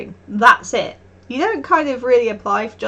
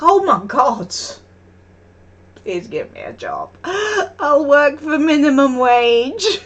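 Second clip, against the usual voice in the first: desperate plea; 4 semitones higher, 5 dB louder, slower than usual. Retold or imitated. Begging the shop staff for a job, in hammed-up desperation. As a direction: mock-desperate begging, breaking into a cry. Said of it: "I'm just like".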